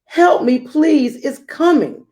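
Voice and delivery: hammed-up panicked king; dismayed shout. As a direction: cry out in alarm as the king. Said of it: "the king shouted in dismay".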